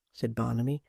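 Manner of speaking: plain reading voice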